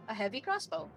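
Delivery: usual speaking voice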